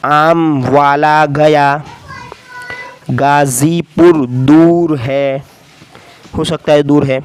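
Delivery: teacher reading a sentence slowly. Shown as a demonstration. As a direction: read it out slowly word by word. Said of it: "आप सुने इसे".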